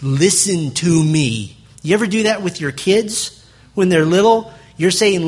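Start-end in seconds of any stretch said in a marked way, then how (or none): 0.0-1.6 "Listen to me" slow and firm, each word weighted; emphatic. Shown as a demonstration. Preacher voicing Jesus demanding attention, "Listen to me" slow and emphatic. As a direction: drive home "Listen to me" slowly, word by word. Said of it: "It's emphatic".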